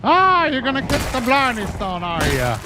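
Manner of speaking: high, hammed-up fake Irish brogue; a bad stage-Irish accent, high and bouncy; awful Irish accent. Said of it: "my very awful Irish impersonation accent".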